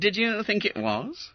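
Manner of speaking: high, lazily teasing question; drawled. Acted. Smithy to the duped fat boy, drawled. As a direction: toy with him, feigning surprise. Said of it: "drawled Smithy".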